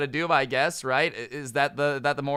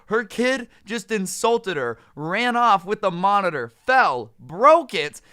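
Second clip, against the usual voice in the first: exasperated rant; 6 semitones higher, 5 dB louder, slower than usual. Real, not acted. Ranting about the mom.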